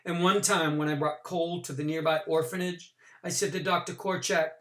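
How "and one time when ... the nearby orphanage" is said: usual speaking voice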